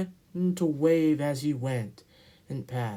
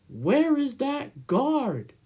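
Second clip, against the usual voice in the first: 1 anxious; 11 semitones higher, 5 dB louder, slower than usual. Acted. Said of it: "Edward was getting anxious".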